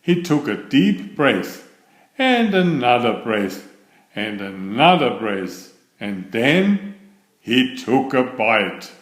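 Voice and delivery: usual speaking voice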